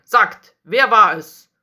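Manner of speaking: loud demand for a confession; stern. A narrator's reading. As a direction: press them hard for a name, very loud. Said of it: "fragte er streng". (streng und laut fordernd)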